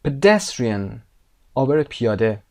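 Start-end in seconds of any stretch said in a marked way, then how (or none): 0.1-1.0 PEDESTRIAN peaking on DES; stress on DES. Shown as a demonstration. Teacher repeats for students, stress on DES in PEDESTRIAN. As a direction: lean hard on DES in PEDESTRIAN. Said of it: "قسمت دس".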